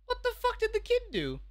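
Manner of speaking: startled disbelief at the clip; shocked. Real, not acted. Streamer reacting to a shocking clip, shocked.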